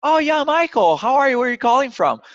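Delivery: high, loud, eager receptionist voice; overly friendly receptionist. Retold or imitated. Receptionist hopes the caller is the CEO's friend, so high and gushing. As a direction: gush at the caller in case he's a friend. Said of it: "They're trying to be friendly".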